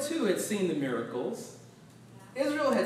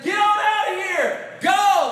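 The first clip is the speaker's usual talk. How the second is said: louder, higher command to leave; Pharaoh's shout; 9 semitones higher, 7 dB louder, slower than usual. Retold or imitated. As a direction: order them out like an angry king. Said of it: "when Pharaoh says".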